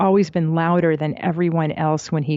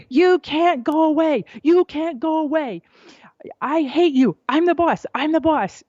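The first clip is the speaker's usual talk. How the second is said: high-pitched toddler tantrum, imitated; mock child's yelling; 10 semitones higher than usual. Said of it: "he yelled and yelled".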